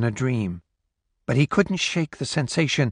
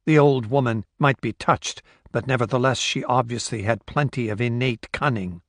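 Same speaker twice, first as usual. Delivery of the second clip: ordinary delivery, nothing marked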